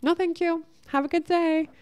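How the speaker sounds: high, sweet and polite; kind refusal. Retold or imitated. Declining a man's advances kindly, high sweet polite voice. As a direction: turn him down sweetly, no edge. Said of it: "you just kindly say".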